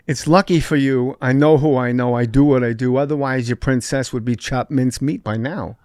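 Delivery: ordinary talk, nothing marked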